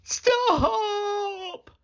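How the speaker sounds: long, very high desperate wail; wavering, high drawn-out cry; boy's cry. A narrator's reading. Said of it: "cried the boy".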